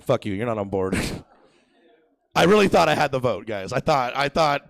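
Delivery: normal talking voice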